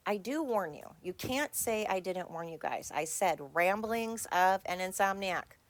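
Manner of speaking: usual speaking voice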